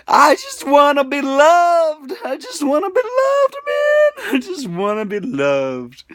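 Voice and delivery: real sobbing confession of loneliness; tearful plea. Real, not acted. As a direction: voice cracking with longing. Confessing loneliness, near tears.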